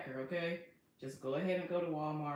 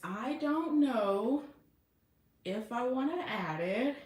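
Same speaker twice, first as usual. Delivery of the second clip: drawn-out doubt; 6 semitones higher, 4 dB louder, slower than usual. Real, not acted. Unsure about topstitching.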